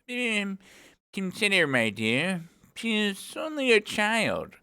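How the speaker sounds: high, hesitant, timid pleading. Acted. King pleading with the queen for Alice, timid. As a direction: plead gently in a high, wavering-pitched voice. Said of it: "timidly said".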